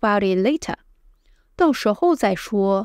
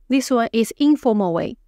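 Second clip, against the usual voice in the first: ordinary talk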